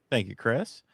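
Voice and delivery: usual speaking voice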